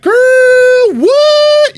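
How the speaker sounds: squealed very high "what"; hammed-up camp squeal; over-the-top sassy. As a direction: shriek it with a hand flip. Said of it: "whipping my hand out".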